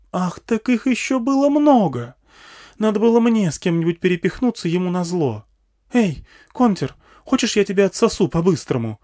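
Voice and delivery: very high, indignant woman's voice; outraged, vengeful woman, pitched right up; offended woman. A narrator's reading. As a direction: lash out in jealous spite. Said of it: "Оскорблённая женщина".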